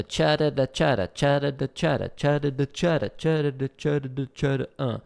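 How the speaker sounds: rolling cha-da-da syllables in a steady three-two rhythm; vocalized drum pattern. Shown as a demonstration. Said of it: "like a three two pattern".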